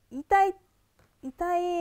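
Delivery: loud sharp ouch; crying out in pain. Real, not acted. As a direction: yelp from real pain. Pressure on sore spot.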